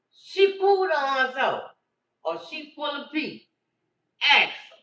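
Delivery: high loud scolding; grandma hollering "Ask her."; loud rant. Retold or imitated. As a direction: berate the daughter over the diaper. Grandma ranting at daughter.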